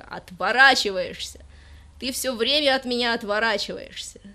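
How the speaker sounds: loud, slow accusing yell; angry shout. Acted. Dimochka yells at the girl who turns away, loud and slow. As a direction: hurl the reproach at her. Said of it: "вскричал Димочка".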